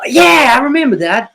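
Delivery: light up at the memory; mock overjoyed remembering; excited recognition. Sudden recognition.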